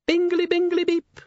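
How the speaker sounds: very high, chirpy electronic-assistant squeak; cheerful gadget voice. A narrator's reading. Bedside gadget waking its groggy owner, very high and cheerful. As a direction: sing out a high, cheery alert. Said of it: "said a cheerful voice".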